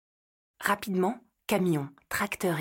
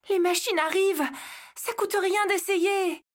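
urgent call; 11 semitones higher than usual. Acted. Leila urgently calls out.

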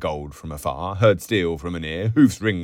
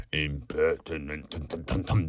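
mocking echo breaking into nonsense syllables; rude mimicry; 4 dB quieter than usual. A narrator's reading. Boots boy mimicking the barmaid's scolding as he retreats, rudely mocking. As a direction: parrot the big word rudely, trailing into mumbo-jumbo. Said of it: "Boots' snout sniffed rudely".

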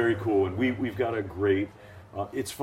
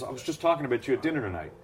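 higher, fast and eager; 6 semitones higher, faster than usual. Retold or imitated. Announcer telling David he was discussed, quick and eager. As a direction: rush it out with enthusiasm. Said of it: "He goes".

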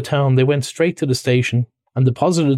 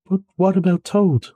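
anxious; 7 semitones higher than usual. A narrator's reading. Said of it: "asked the mole anxiously".

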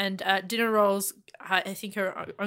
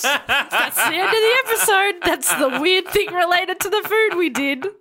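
laughing; 5 semitones higher, 4 dB louder than usual. Real, not acted. Laughing wrap-up.

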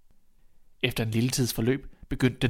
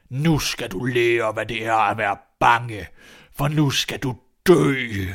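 put-on slow, loud, menacing old man; shouting old giant; 6 dB louder, slower than usual. A narrator's reading. Huge old man vowing death to the boy, booming. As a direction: thunder the threat, stretching the words. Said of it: "råbte han".

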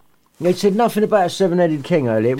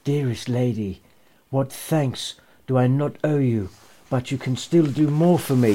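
mournful Nutcracker; 4 semitones lower, 5 dB quieter than usual. Acted. Nutcracker speaking to Maria in her dream, mournful. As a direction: lower and softer, quietly mournful. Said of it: "saying mournfully".

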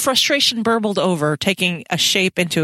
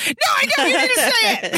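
squeaky mock retort, much higher; faster than usual. Teasing the hero about his feelings, in a squeaky mock voice. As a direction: pitch it way up to tease. Mocking Diana's retort, high voice.

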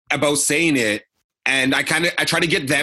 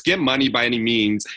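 ordinary delivery

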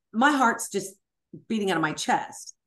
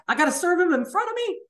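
high, fast incredulous question; shocked disbelief; 11 semitones higher, 5 dB louder, faster than usual. Retold or imitated. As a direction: blurt it out in shock. Shocked reply to attorney's plan.

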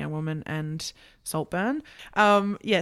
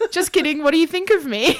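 high, loud needy plea through laughter; joking desperate bid for approval; mock needy backpedal; 9 semitones higher, 7 dB louder, faster than usual. Acts out needy subtext, laughing.

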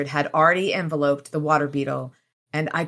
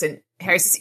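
normal talking voice

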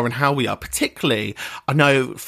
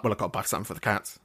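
rattled off under the breath, much softer and fast; quick muttered excuse; 5 semitones lower, 10 dB quieter, much faster than usual. Retold or imitated. Host imitating herself at the cat shelf, fast soft mutter. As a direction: mumble it quickly like a guilty excuse. Self-quote entering discount shop.

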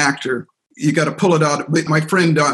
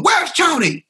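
much higher mock heckler call; retold crowd yell; 14 semitones higher, slower than usual. Australian crowd heckling the stand-in host, high mock yell. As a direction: toss it like an annoyed crowd. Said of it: "people were yelling at me".

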